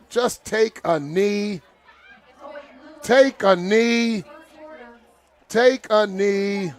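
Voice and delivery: slow, drawn-out plea to kneel; slow urging chant; slow pleading. Real, not acted. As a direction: stretch each word, begging them to take a knee. Commentator urging team to kneel.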